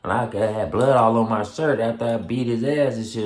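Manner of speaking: ordinary talk, nothing marked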